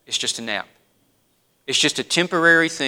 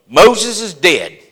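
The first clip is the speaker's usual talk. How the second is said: firm and final; much slower than usual. Acted. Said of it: "There is a firmness and a finality".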